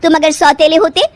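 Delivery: scornful. Acted. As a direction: sneer at her pretended love.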